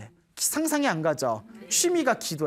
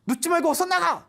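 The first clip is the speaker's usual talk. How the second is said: higher, louder urgent cry; mother's loud warning; 11 semitones higher than usual. Retold or imitated. Mother warning her son in the barracks, urgent loud cry. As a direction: shout the warning like a frightened mother. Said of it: "이렇게 소리가 보럭 들리더니".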